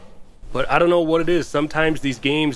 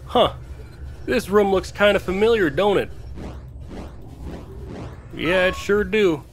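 hillbilly voice; 7 semitones higher than usual. Pretend. Jokey put-on yokel voice.

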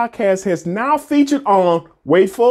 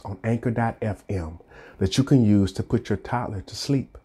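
soft bedtime story voice; 11 semitones lower, 7 dB quieter than usual. Acted. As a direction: read it like a lullaby intro. Soft ad read for podcast.

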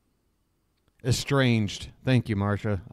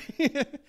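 embarrassed laugh through tears; a wet, choked laugh; teary high laugh; 12 semitones higher than usual. Real, not acted. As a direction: laugh off the emotion, pitch up. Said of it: "I'm crying now".

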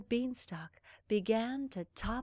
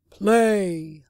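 loud one-word giant order; commanding; 12 dB louder than usual. Acted. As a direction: bark it as a command. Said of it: "the giant commanded".

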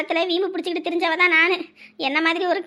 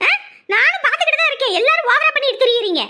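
raised, indignant complaining; angry quarrel; 4 semitones higher, 3 dB louder than usual. Acted. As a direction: pour out your grievances in a high, heated voice. Wife quarrelling with husband.

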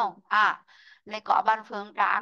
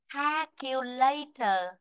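careful re-reading; 3 semitones higher, much slower than usual. Shown as a demonstration. Teacher corrects mistaken students, slow careful model. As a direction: stretch out each part of "Calculator". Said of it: "Để đọc lại này".

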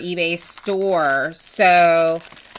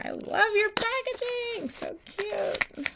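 cutesy high voice; 6 dB quieter than usual. Acted. Gushing over cute packaging.

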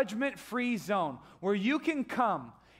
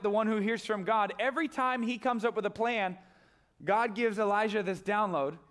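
normal talking voice

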